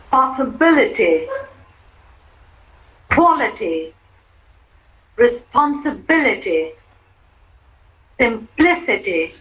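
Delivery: "Activity", "Electricity", "Quality" stressed on the third syllable from the end. Shown as a demonstration. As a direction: model words for repeating, hitting the syllable before -ity. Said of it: "on the third syllable from the end".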